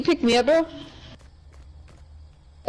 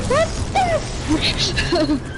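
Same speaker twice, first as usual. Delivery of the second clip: shocked; 11 semitones higher, much slower than usual. Retold or imitated. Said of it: "I'm like".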